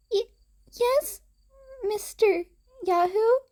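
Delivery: put-on small, high, timid little girl with a catch in it; a shy child, barely getting the words out; young girl's voice. Little Eri answering Izuku, high timid child voice.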